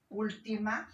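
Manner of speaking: normal talking voice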